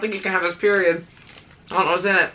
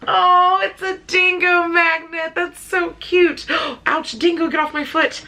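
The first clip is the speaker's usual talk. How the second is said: excited cooing; 10 semitones higher than usual. Real, not acted. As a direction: gush over the toy. Unwrapping dingo toy.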